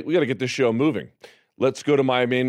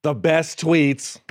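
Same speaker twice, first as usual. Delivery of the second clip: very slow bad Trump impersonation; badly faked Trump delivery; terrible Trump; 3 semitones higher, much slower than usual. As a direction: draw each word out like a poor Trump. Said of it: "my terrible Trump impression".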